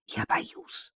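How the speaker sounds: very soft, voiceless whisper; frightened whisper. A narrator's reading. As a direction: whisper it as a scared confession. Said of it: "прошептала Татьяна".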